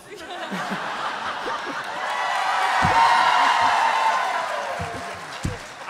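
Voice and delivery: loud breathy burst of laughter; a big high breathy laugh; big unvoiced laugh. Big laugh after the vow.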